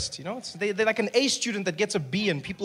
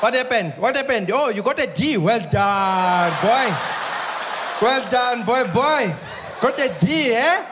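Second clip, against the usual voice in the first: indulgent parent. Retold or imitated. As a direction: praise a failing child far too warmly. Parent praising a weak child.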